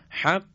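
حق with the ق closed off sharply; stopped qaf demo. Shown as a demonstration. Tajwid teacher demonstrating a full stop on qaf. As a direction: cut the air dead on the ق of حق.

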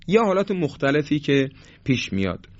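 ordinary talk

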